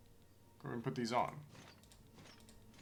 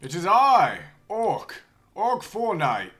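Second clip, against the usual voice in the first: very loud, high heroic proclamation; stagey fake archaic declaiming; 12 semitones higher, 15 dB louder than usual. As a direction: announce yourself like a knight. Announcing himself as knight.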